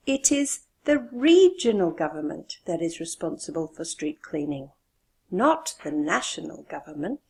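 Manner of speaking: regional and national punched; stress on regional and national. Shown as a demonstration. Teacher repeats the example, stressing regional and national. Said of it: "did you hear the stress on regional and national".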